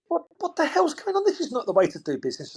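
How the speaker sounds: high-pitched retold outburst of disbelief; mock a baffled objection, pitch jumping up; flustered protest. Colleague objecting to how the fish deal is going, flustered protest.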